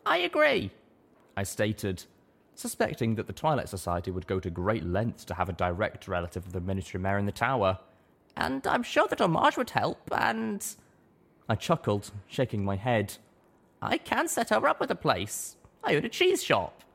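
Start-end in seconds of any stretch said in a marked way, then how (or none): 0.1-0.7 a small pony caught off guard; high, slowed little pony voice; young mare. A narrator's reading. 8.4-16.8 high, plain little mare voice; a small pony chatting; high little pony voice, chuckling aside; young mare. A narrator's reading.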